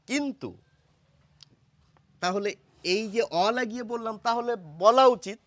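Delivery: usual speaking voice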